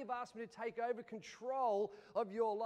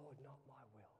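whispered, much softer surrender prayer; hushed prayer; 10 semitones lower, 12 dB quieter than usual. Retold or imitated. Pastor surrendering to the Lord in a hushed prayer. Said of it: "I go".

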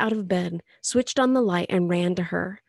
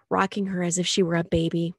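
plain reading voice

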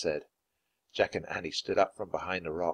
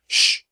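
sharp, loud shush; urgent "shh" to silence a sister; hushing; 8 dB louder than usual. A narrator's reading. Jack hushes Annie.

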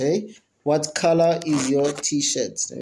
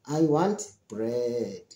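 low, slow and deliberate, each word separate; drawn-out demonstration of the answer; slow, clear model answer; 6 semitones lower, 5 dB quieter, much slower than usual. Shown as a demonstration. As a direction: spell out the answer slowly for learners. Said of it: "So that's gonna be your answer".

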